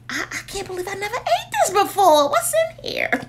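high, laughing disbelief; excited laughing. Real, not acted. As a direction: giggle with excitement. Thrilled about the new candle.

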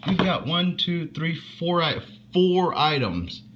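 high, amazed exclamation; surprised. Real, not acted. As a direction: gasp it out in real amazement. Amazed at the box contents.

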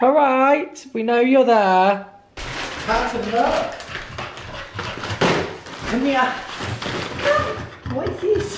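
bright, high voice for the dog; high, sing-song talk to a puppy; high pet talk. Real, not acted. Talking to her dog Carter.

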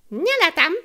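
put-on high, loud boyish denial. Felek denying to his brother, loud. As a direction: protest hotly.

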